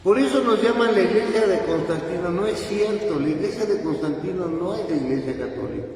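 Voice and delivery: loud, high, driving sermon voice; fervent preaching. Acted. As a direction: preach it at full force to the congregation. Priest preaching with rising force.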